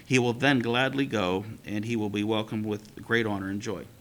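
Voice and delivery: steady read-aloud storytelling voice; story reading. A narrator's reading. Teacher reading a midrash to the class, storytelling. Reads God's words in story.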